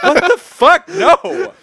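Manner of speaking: high, loud laughing disbelief; panicked disbelief. Retold or imitated. Recalling the punch at breakfast, high loud laughing disbelief. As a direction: yelp it out half laughing, way up high. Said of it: "I was like".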